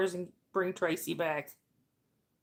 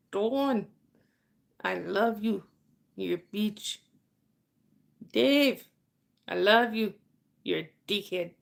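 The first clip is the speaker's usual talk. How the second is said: half-successful Irish impression, pausing after each phrase; Papa's Irish accent. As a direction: imitate him with a light Irish lilt. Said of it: "with his Irish accent".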